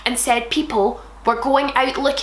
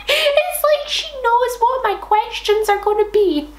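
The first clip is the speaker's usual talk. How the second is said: amazed laughing exclaim; 13 semitones higher than usual. Real, not acted. Host marvels at the fic answering her questions, high and amused. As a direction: squeal it in delighted disbelief.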